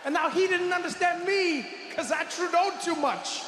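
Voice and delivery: flustered comic outburst; embarrassed rant. Real, not acted. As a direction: rant at your own blunder, voice climbing.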